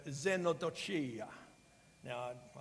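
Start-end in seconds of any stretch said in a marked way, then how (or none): 0.0-1.3 xenodochia said with an Italian accent, vowels rolled open. Real, not acted. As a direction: pronounce xenodochia the Italian way, lightly. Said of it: "why I just pronounced that in an Italian accent".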